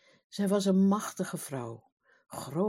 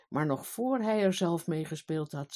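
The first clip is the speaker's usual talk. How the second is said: ordinary delivery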